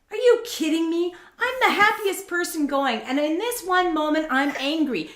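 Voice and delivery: high-pitched, indignant mock outburst; angry. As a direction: act out being fed up with cheering-up. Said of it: "moments where I was angry".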